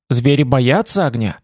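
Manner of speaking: plain reading voice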